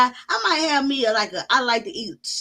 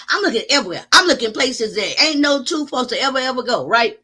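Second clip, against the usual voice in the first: ordinary talk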